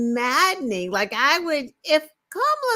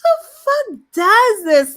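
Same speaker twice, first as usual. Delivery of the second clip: higher, louder, outraged disbelief; exasperated outburst; 9 semitones higher, 8 dB louder than usual. Real, not acted. Cohost reacting to Trump's tweet, loud outrage. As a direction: blurt it out, appalled.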